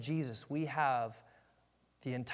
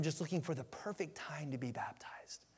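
whisper; 10 dB quieter than usual. Real, not acted. Pastor advising, drops to whisper.

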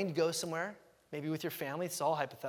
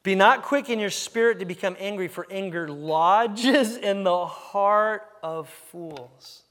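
very slow, weighty and louder; slow reading; 2 semitones higher, 8 dB louder, slower than usual. Shown as a demonstration. Pastor rereads the verse to the congregation, slowly. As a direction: lean on every word and let it sink in. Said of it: "Let's read that one slow again".

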